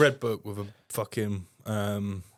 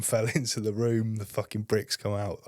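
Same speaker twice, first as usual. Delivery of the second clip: ordinary talk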